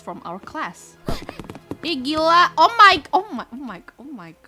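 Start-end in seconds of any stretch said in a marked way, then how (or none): 1.0-1.8 shocked gasp. Real, not acted. Shocked by the scene. 1.9-4.3 loud, high shocked cry; shocked outburst. Real, not acted. Reacting in shock.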